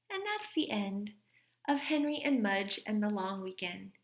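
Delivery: gentle read-aloud storytelling voice; story reading. A narrator's reading. Teacher reading a story to her class, gentle read-aloud. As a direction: read it like a teacher sharing a picture book with kids. Reads a picture book aloud.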